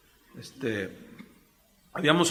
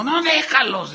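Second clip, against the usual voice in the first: Jesus rebuking; 8 semitones higher, 7 dB louder than usual. Retold or imitated.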